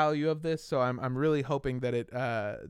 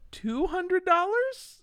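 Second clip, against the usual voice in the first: high, slow price reveal; mock ad pitch; slower than usual. Host teasing listeners with a silly price, high and slow.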